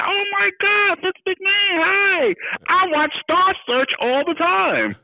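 very high, gushing fan impression; high-pitched squeal of recognition, drawn out at the end; starstruck mother. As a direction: imitate a thrilled mom meeting a celebrity. Mother thrilled by celebrity on phone.

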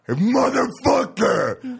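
high, very slow, loud yell; mock yell. Shoe thrower yelling at the president, slow high yell. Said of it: "somebody got up and going".

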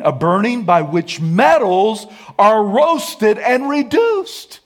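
high, loud preacher's shout; full-force pulpit shout; fiery preaching. Acted. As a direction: preach it hard, rising to a cry. Preaching climax on the fire.

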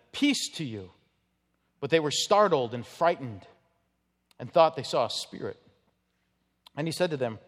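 formal Bible reading voice; scripture reading. Acted. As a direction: read the Gospel text steadily to the congregation. Reads the Gospel passage aloud.

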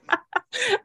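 high-pitched helpless laugh; real laugh. Real, not acted. Host admits breaking down to co-host, laughing helplessly. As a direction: burst out laughing at your own confession. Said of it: "I'm laughing".

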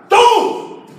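high, abrupt acted cry; one sharp mock yelp; sudden outburst. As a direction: burst out with it in alarm. Acted outburst at little brother.

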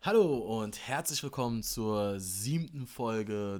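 announcer intro. Acted. Podcast intro.

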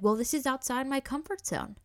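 mock the doctor's excuse; mock defensive doctor. Doctor defending himself to the mother, mock defensive.

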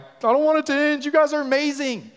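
high, loud burst of enthusiasm; excited gushing. Retold or imitated. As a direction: blurt it out, thrilled, high and loud. Acts out his later enthusiasm at group.

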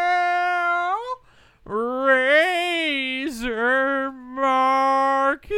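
very high, very slow, loud; high drawn-out announcement. Pretend. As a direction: stretch every syllable up high.

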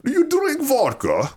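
high, slow Russian professor; Russian accent. Retold or imitated. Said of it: "This, this Russian professor".

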